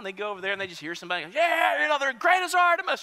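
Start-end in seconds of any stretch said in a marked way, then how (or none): 1.3-3.0 high, loud acted chant; mob yelling. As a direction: yell the slogan like a rioter. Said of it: "they start yelling and screaming".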